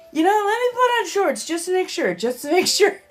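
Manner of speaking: high, loud imitation of her earlier self; excited quote. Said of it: "I was like".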